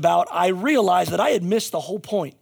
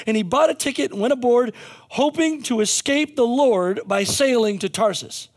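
raised, lifted reading of the Bible passage; scripture reading; 5 semitones higher than usual. Acted. Preacher reading Jonah aloud to the congregation, lifted voice. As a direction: read the scripture out with energy.

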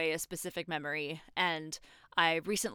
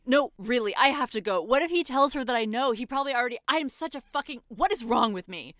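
high, loud and rushed; frantic panic; 5 semitones higher, 7 dB louder than usual. Acted. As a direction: panic spiralling out of control. Frantic, hurrying off the call.

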